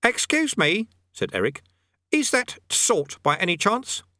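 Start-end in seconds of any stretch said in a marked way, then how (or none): 0.0-0.9 put-on high, young boy asking; boy's voice. A narrator's reading. Eric politely interrupts sergeant. 2.1-4.0 put-on high, young boy asking; boy's voice. A narrator's reading. Eric asks the sergeant.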